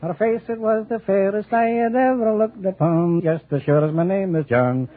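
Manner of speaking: slow, hammed-up sentimental recital of a Scottish lass; lightly hammed lovesick Scotsman; mock Scottish ballad. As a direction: croon it like a corny old Highland love song, tongue in cheek.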